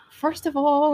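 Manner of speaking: high, loud mock-indignant objection; mock indignation. Student objecting to friends' reassurance, mock indignant.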